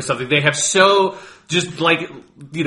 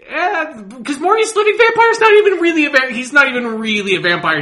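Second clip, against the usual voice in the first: exasperated; 11 semitones higher, 3 dB louder than usual. Real, not acted. Exasperated rant.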